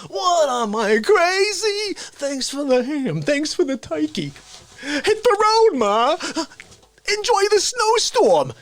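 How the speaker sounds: very high, loud, drawn-out mock rage; Dick Facce. Son throwing his mother out at Christmas, high hammed-up yelling. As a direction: wail it out as an indignant New York loudmouth. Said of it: "That's what Facce does".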